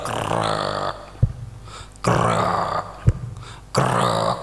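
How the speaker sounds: long low creaking croak; drawn-out guttural crack noise; imitated cracking. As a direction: creak like bones being stretched. Imitates body creaking under traction.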